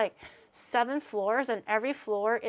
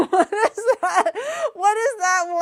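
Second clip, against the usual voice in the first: loud, high, laughing shock; laughing disbelief; 9 semitones higher, 11 dB louder than usual. Real, not acted. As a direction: laugh out what is that.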